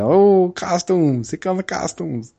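high, drawn-out mock henchman cry; hammy game mobster sounding the alarm; mobster impression. Mobsters seeing superheroes arrive, high drawn-out mock alarm. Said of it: "they'd always say that".

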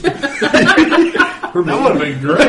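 laughing out the words. Real, not acted. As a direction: speak while laughing hard. Group laughing.

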